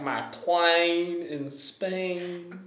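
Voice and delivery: drawn-out mock Cockney vowels; very slow, exaggerated try at Cockney; Cockney accent. Imitating the musical heroine for a co-host, bad Cockney accent. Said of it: "trying to fix her Cockney accent".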